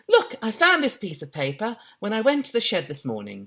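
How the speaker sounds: put-on high, prim girl voice; high-pitched, proper young lady; posher girl voice. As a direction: read it like a well-spoken schoolgirl. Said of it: "She's gotten posher as well".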